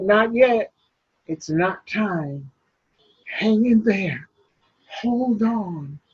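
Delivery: soft reassurance. Acted. Toby soothing Sarah.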